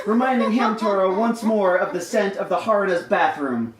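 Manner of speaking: ordinary talk, nothing marked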